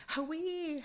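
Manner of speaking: "Hawaii" high and bright; Kwakwala word. Retold or imitated. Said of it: "I'm like".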